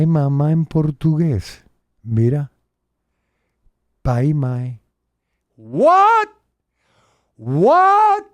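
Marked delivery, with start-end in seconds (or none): 5.6-8.2 high-pitched, loud "what?" held at the top; mock astonishment. Vlogger reacting to Portuguese words for parents, mock astonishment. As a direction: squeal a hammed-up, incredulous what.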